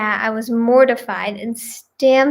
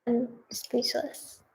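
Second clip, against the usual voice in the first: "I'm speechless" dropped to almost nothing, slow; floored, barely saying it; stunned, much softer; 14 dB quieter, slower than usual. Real, not acted. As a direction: let "I'm speechless" fade out, slow and near silent. Reacting to jail-cell wedding story.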